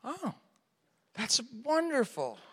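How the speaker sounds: high, soft overly sweet praise; a high, quiet put-on cheer; forced delight. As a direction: fake enthusiasm to hide disappointment. Feigned delight at walking.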